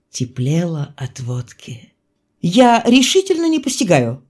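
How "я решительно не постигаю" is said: raised pitch, worked-up bewilderment; excited, higher-pitched outburst; agitated. A narrator's reading. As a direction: protest in agitated disbelief. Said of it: "заговорил я возбуждённо".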